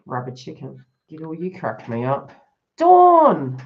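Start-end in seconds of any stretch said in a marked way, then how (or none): 2.8-3.6 loud high call to grab her attention; calling out a name. Real, not acted. Reader loudly calling out to viewer Dawn in the chat. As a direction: shout her name across the room.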